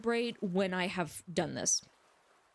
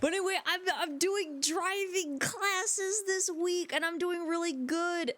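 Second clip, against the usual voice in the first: strained, very high put-on voice; pushed-up voice; 10 semitones higher than usual. Shown as a demonstration. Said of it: "that hurts my throat".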